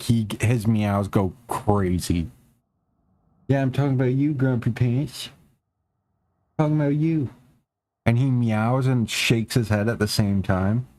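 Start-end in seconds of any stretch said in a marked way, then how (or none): 3.5-7.3 playful pet talk, a little higher; teasing the cat. Real, not acted. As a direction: tease the grumpy cat fondly.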